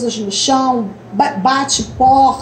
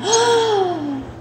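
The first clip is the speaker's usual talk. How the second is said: surprised gasp; 8 semitones higher, 4 dB quieter than usual. Shown as a demonstration. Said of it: "Aquela carinha assim de surpresa".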